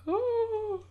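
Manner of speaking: a small, unalarmed oh; mild oh. Retold or imitated.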